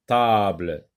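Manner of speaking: ordinary talk, nothing marked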